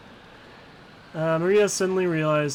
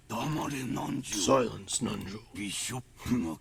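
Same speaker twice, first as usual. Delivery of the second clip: put-on stern old man; 5 semitones lower, 5 dB quieter, much slower than usual. Kinzo snaps at his doctor.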